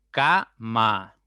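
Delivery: "cama" split into ca - ma, each part loud; syllable by syllable. Shown as a demonstration. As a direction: break "cama" into two separate beats, ca, ma. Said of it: "ya tienen dos sílabas".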